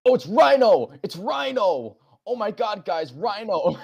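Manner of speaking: high, loud hammed-up fanboy squeal; mock excited reactor. Mimicking a YouTube reactor, hammed-up excitement. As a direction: ham up a wrongly thrilled fan. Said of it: "he has the voice, and he goes".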